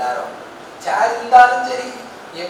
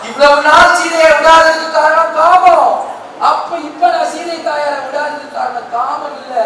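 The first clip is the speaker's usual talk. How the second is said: ordinary talk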